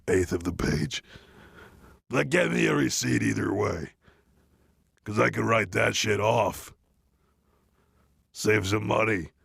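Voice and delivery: usual speaking voice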